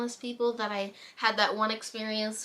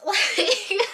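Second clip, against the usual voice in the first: high, loud real laughter; burst of laughter; 11 semitones higher, 7 dB louder than usual. Real, not acted. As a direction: crack up laughing mid-story. Laughing at the guy's reaction.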